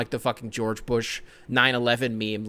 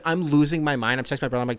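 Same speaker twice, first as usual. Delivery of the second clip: ordinary talk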